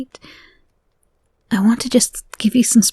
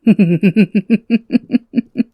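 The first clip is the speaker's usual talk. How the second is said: creepy laugh. Acted. Captor laughing at captive.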